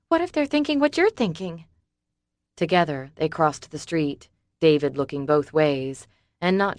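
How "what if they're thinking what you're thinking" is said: higher, worried woman's voice; Summer's voice. A narrator's reading. Worried Summer questioning David, anxious. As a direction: fret out the question.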